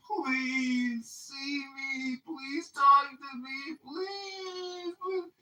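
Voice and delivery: high, slow, tearful begging; desperate pleading girlfriend. Retold or imitated. Girlfriend pounding on the door, begging in a high, tearful voice. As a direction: beg through tears in a high voice. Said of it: "pounding on my door".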